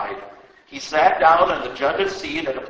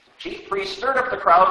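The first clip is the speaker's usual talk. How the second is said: usual speaking voice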